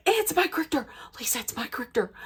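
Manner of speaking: whispered, thrilled aside; excited whisper. Retold or imitated. Whispering to Lisa with hand over the receiver. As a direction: hiss the news so he can't hear. Hushed aside while on phone.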